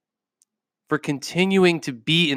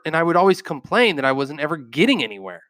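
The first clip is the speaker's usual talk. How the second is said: ordinary delivery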